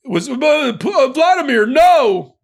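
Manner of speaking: high-pitched, stammered mock plea building to a loud "no"; mock pleading. Host mocking Trump's appeal to Putin, mock pleading.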